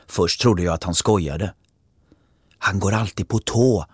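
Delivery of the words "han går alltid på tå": ordinary delivery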